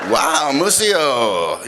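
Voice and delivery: slow, drawn-out impressed "wow"; amazed. Retold or imitated. Storyteller reacting to friend's taxi-theft tale, drawn-out amazement. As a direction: stretch the words out in acted wonder.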